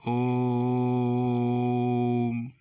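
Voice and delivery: chant-like ओम held on one steady note; very long level ओ closing into म; ओ prolonged in ओम. Shown as a demonstration. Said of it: "ओ को हम काफ़ी देर तक बोलते हैं".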